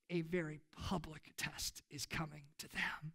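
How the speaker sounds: a dramatic whispered aside. Real, not acted. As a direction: breathe it out as a grave warning. Dramatic hushed moment in sermon.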